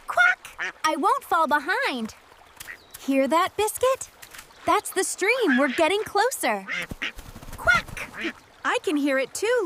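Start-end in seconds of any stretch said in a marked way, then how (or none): 0.1-0.7 a sharp quack, much higher; duck quack. Acted. 7.6-8.1 a sharp quack, much higher; duck quack. Acted.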